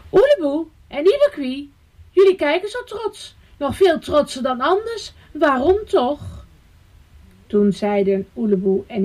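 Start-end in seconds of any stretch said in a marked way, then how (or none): 0.1-6.5 high-pitched, questioning bird voices; curious little birds. A narrator's reading. Passing birds ask why they look proud, high and curious. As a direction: ask in a raised, piping voice.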